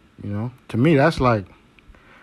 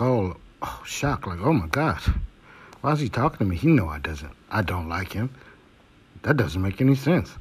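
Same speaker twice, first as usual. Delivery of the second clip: hushed, fake-innocent mock surprise, a bit softer; mock shocked gaslighter; 3 semitones lower, 4 dB quieter than usual. Mocking the gaslighter who acts shocked to be approached, in a softer fake-innocent voice. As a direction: mimic the faux-shocked manipulator playing victim. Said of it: "it's like their chance to be like".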